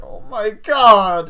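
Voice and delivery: loud, high, drawn-out mock wail; horrified outcry. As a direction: imitate a shocked scream, stretched slowly.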